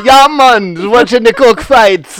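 laughing, badly faked Jamaican accent; botched British-turned-Jamaican accent. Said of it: "Like, what is that, Jamaican?".

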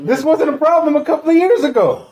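high, indignant comic complaint; exasperated rant. Real, not acted. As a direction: protest with the pitch way up. Comic rant after the restaurant story.

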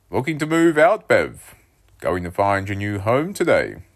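loud, high joking dad; teasing dad. A narrator's reading. Dad joking with his son at breakfast, loud and teasing. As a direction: tease him with a big grin. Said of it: "laughing into his coffee cup".

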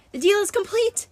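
high, strained character groan; groaned proclamation. A narrator's reading. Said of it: "Nereus groaned".

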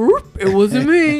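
slow, loud sing-song it wasn't me. Retold or imitated.

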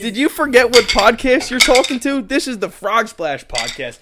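hyped announcer. Acted. Hype podcast shout.

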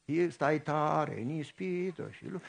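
a steady voice telling him to hold on; calm answering voice. Acted. To the impatient pastor, slow soft answering voice.

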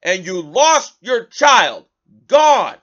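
loud mock yell, slowed down; imitating a yell. Host mocking the president's yell at reporters, loud. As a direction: bellow it like an angry old man at a press conference. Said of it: "Then again".

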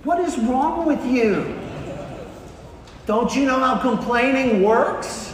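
high, incredulous mock outrage; indignant scolding. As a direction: scold him for breaking the unwritten rule. Onlookers scold the coach, acted.

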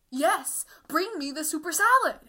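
exasperated retold order; loud insistence. Insisting to the waiter in retold quarrel.

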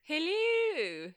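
high, hammed-up posh hello. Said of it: "You sound like a right posh little tart".

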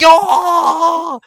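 very high, loud, long shout; hyped-up cheer. Acted. Dudes leave the meeting hyping, shouting. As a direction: roar approval like the whole crew. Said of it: "They all leave hyping".

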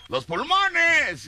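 very high, slow call; shout from the back. Retold or imitated. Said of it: "se oye otro".

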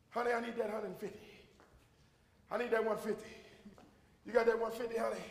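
a lightly voiced husband asking sweetly; husband asking for money. A husband asking his wife for the money, soft.

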